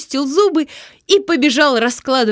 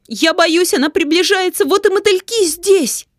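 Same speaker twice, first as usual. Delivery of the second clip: frightened cry; 8 semitones higher than usual. Acted. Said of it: "закричала одна девочка".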